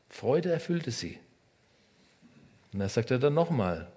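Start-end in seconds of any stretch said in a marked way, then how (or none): 0.1-1.2 joyful take. Shown as a demonstration.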